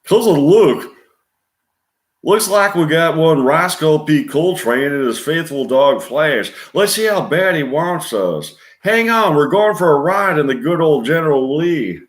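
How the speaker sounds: high, loud, excited Southern driver; Southern accent. Retold or imitated. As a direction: yell with Southern excitement mid car chase. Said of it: "speaking with Southern accent".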